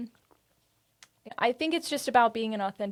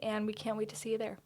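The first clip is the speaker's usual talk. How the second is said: ordinary delivery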